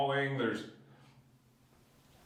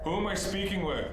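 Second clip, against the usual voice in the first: raised, higher-pitched question; questioning a spirit; 7 semitones higher than usual. Real, not acted. Investigator asks who is speaking, high questioning call. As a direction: call out to an unseen presence.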